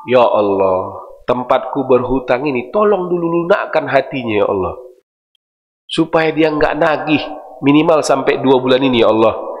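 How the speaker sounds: a hushed supplication to Allah; humble prayer. Acted. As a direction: pray it humbly, voice dropped low. Acted prayer for the creditor.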